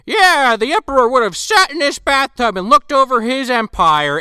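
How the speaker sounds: high, loud documentary host voice; Peter Weller host. Retold or imitated. Peter Weller showing off the emperor's bathtub, high. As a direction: present it like a TV host. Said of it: "he's like".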